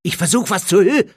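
tense, cut short; urgent impatience. Acted. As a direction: say it exasperated, breaking off. Harry urgently hushes Dudley.

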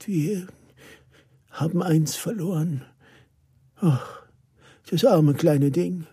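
fighting tears. A narrator's reading. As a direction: struggle through it, holding back tears. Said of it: "Sie kämpfte mit den Tränen". (mit den Tränen kämpfend)

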